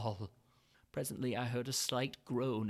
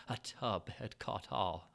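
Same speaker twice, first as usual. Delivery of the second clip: sly gloating chuckle; 6 semitones lower, 4 dB quieter, slower than usual. A narrator's reading. Gloating over the clean deed.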